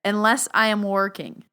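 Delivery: ordinary talk, nothing marked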